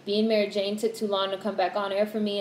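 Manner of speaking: ordinary talk, nothing marked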